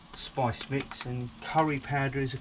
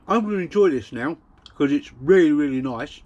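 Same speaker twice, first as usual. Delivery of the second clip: talking with a mouthful; mouth full; 9 dB louder than usual. Real, not acted. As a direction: talk through a bite of food. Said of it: "eat with my mouth full".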